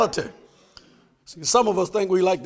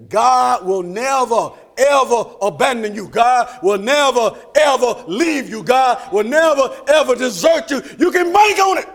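full-force preaching; 5 semitones higher, 6 dB louder than usual. Acted. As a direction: hammer each "never" home.

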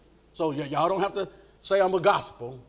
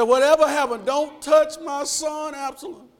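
high, raised, insistent charge; pleading command; 9 semitones higher, 7 dB louder than usual. Acted. David ordering his army to spare his son, high and insistent. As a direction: stretch out the plea in a high voice.

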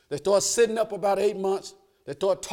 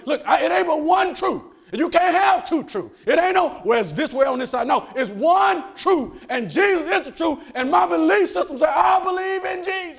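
high, loud sermon declaration; full-force preaching; 10 semitones higher, 6 dB louder than usual. Acted. Preacher declaring one truth to the church, full-force high preaching. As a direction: drive the truth home from the pulpit.

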